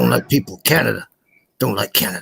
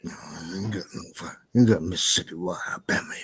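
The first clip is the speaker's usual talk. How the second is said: ordinary talk, nothing marked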